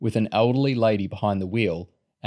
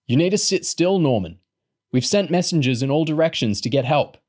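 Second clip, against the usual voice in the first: higher, insistent reassurance; calming down; 6 semitones higher than usual. A narrator's reading. Boy calming a sick man, reassuring. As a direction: try to settle him, voice raised. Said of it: "Rat tried to calm him down".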